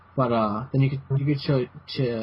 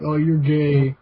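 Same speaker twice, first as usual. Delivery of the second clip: loud mock jeer; jeering commenter impression; mocking taunt; 3 semitones higher, 7 dB louder, slower than usual. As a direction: imitate a heckler, louder and drawn out. Mimicking YouTube commenters' taunt.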